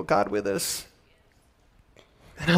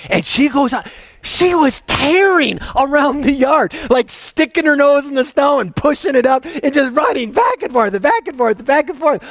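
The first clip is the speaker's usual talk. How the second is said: excited; 10 semitones higher, 4 dB louder than usual. Real, not acted. Excited retelling of puppy's romp.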